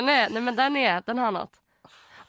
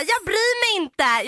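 higher, slow careless reply; carefree friend; 8 semitones higher, much slower than usual. Retold or imitated. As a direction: shrug it off lightly. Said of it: "Hon bara".

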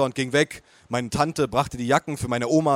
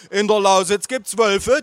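slow, higher, louder proclamation; declaiming; 7 semitones higher, 6 dB louder than usual. Acted. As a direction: announce it slowly like a grand decree. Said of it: "deklamierte mein Vater".

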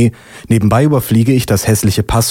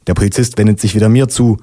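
plain reading voice

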